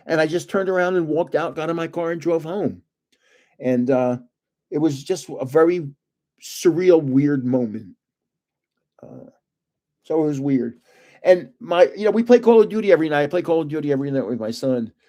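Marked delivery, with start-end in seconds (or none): none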